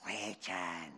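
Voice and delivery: low, hushed demon voice; demonic voice. Acted. Demon answering Jesus with its name, low and hushed. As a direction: drop low and quiet for the demon.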